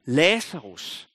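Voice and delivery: drawn-out calling of the name; preacher retelling Jesus' call, slow and drawn out. Retold or imitated. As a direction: stretch the name slowly as a call. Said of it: "Så han råbte".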